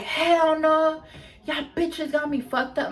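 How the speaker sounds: high-pitched mock outrage, not shouted; imitated indignation. Girl confronting the party over her shoes, imitated outrage.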